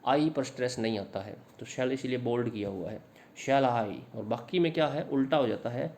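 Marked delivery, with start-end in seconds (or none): 3.4-4.2 "shall I" with SHALL strong and a falling, drawn "I"; stress on SHALL. Shown as a demonstration. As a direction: hit "shall", leave "I" unstressed. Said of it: "शेल पर stress देना पड़ेगा". ("shall" पर ज़ोर देकर, "I" हल्का)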